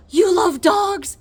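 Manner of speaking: light up suddenly at the word dogs; excited doctor. Retold or imitated. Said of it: "his entire demeanor changes".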